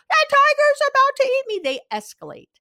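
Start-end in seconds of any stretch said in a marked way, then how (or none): 0.1-1.6 loud, far higher panicked cry; escalated panic. Retold or imitated. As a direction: scream it like the tiger is on you. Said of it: "what do they do? ... They escalate".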